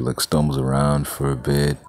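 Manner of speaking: ordinary delivery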